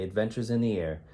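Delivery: ordinary delivery, nothing marked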